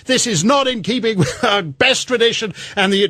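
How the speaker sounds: usual speaking voice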